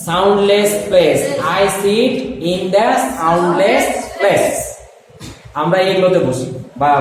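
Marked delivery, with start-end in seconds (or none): 0.1-4.6 drawn out word by word for students to write; teacher dictating the answer slowly; slow dictation. Shown as a demonstration. Teacher reads the model answer aloud for students, very slow.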